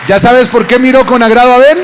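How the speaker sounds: full-force high shout; preaching shout. Real, not acted. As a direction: hit the climax as hard as you can.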